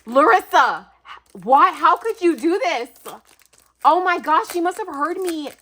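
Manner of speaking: loud, high exclamations of disbelief; astonished. Real, not acted. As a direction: call out to the giver, overwhelmed.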